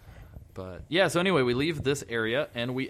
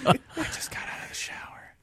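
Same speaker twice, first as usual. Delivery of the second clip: mock-intimate ASMR whisper. Joking co-host adding a line for the captive, teasing whisper.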